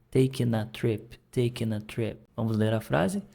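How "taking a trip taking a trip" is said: "taking a" run together, the n joined to a; linked taking a. Shown as a demonstration. Teacher models linked speech for students. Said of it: "juntei lá no A".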